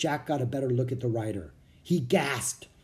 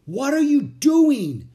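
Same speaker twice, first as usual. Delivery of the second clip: much higher and louder, slow alarmed shout; 12 semitones higher, 9 dB louder, slower than usual. A narrator's reading. Jack shouting at Annie in Washington's boat. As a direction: yell at her from the water's edge. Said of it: "Jack shouted".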